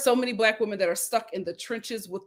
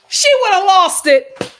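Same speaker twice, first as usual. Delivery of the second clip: excited; 9 semitones higher, 13 dB louder than usual. Real, not acted. Imagining her reaction.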